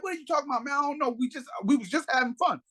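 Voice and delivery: high-pitched imitation of a baffled woman; mock indignant woman. Voices the woman pleading.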